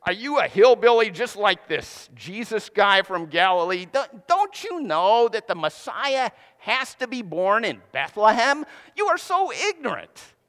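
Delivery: high, sneering ridicule; mocking scorn. Pharisees mocking Nicodemus as a Galilean hillbilly, sneering. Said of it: "so they're asking".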